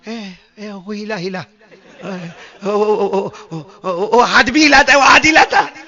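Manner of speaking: hammed-up panic, rising to loud; mock frightened stammer. Grown man at night, imitated in mock fright. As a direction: stutter like a grown man seeing a ghost. Mocking scared grown men.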